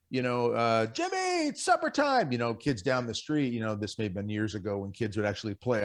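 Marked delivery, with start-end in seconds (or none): 0.9-2.3 put-on much higher sing-song call to a kid; mock mother calling. Mother calling son home from the door, high mock call. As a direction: imitate a mom calling her kid in for supper. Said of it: "if a mother yells out the door".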